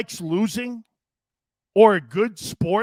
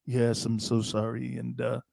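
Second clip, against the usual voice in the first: lower, mock-sincere little apology; mock Bill apology; 7 semitones lower, 5 dB quieter than usual. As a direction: imitate Bill sheepishly saying sorry, tongue in cheek. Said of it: "Bill's apologizing to the media now".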